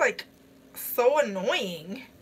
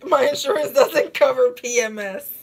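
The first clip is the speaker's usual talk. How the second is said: louder, laughing through the quoted line; laughing quote; 7 dB louder than usual. Retold or imitated. Streamer quoting the film to viewers, laughing and louder. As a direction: burst out laughing mid-line.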